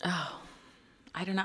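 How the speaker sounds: normal talking voice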